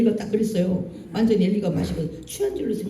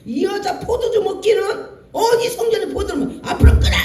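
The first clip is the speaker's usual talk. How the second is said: high, laughing rebuke ending in a loud rasp; mock-bark at the woman; mock angry pastor; 10 semitones higher, 3 dB louder than usual. Pastor mocking Hannah at the temple, high and loud. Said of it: "이렇게 말하는 그 목사".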